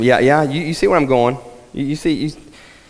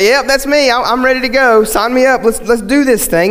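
mock eager volunteer; 8 semitones higher, 4 dB louder than usual. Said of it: "nobody in their right minds will be".

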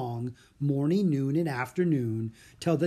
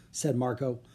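ordinary delivery, nothing marked

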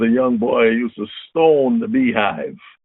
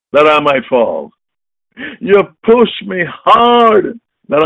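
higher, louder verse, slow on the final line; preaching at full force; 6 semitones higher, 4 dB louder than usual. Acted. Preacher quoting the psalm to his congregation, full force. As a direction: drive the verse home, rising to the Lord.

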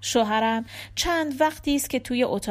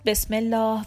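ordinary delivery, nothing marked

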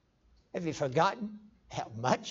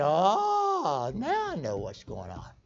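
retold dawning realization; 2 semitones lower, much slower than usual. Preacher recalling reading his Bible, mock dawning realization. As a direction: act out the penny dropping.

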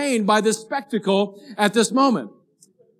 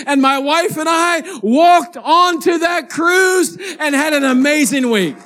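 high, loud, shouting preacher's climax; preaching at full force; 7 semitones higher, 3 dB louder than usual. Acted. Pastor shouts the story's climax to his church, full-force preaching. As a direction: whip the congregation up with the story.

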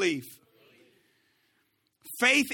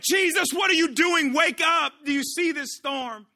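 loud, high panicked demand; freaking out; 10 semitones higher, 8 dB louder than usual. Acted. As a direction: shout to rouse a sleeper in a storm. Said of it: "We're freaking out".

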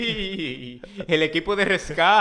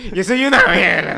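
louder, laughing mock outrage; mock angry mom; 3 semitones higher, 8 dB louder than usual. Mother indignant at her daughter, mock angry. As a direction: play the offended mother half-laughing.

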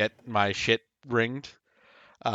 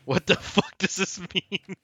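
higher, slow, breaking into laughter; laughing disbelief; 7 semitones higher than usual. Real, not acted. Contestant baffled by the Sandman quiz, laughing. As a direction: ask it helplessly through laughter.

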